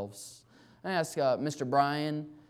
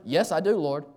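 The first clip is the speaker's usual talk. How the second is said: higher, louder burst of assent; eager reply; 4 semitones higher, 6 dB louder than usual. Retold or imitated. Preacher answering God's call to be under shepherd, eagerly. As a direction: answer up quickly, keen to accept.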